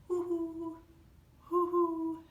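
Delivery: high, loud hooting owl call; playful owl hoot for story time. Acted. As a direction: hoot like a friendly owl for the kids. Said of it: "how an owl says hello".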